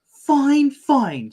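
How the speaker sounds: high, loud, then dropping; exasperated mom. Acted. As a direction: give in with a huff. Chili gives in.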